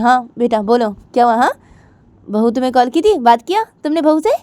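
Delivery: lightly voiced mother. Jiya's mother on the phone asking about the bride. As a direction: ask gently like a mum.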